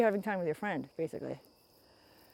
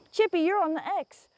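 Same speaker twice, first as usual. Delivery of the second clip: high coo; 10 semitones higher, 5 dB louder than usual. Real, not acted. Talking to the chipmunk.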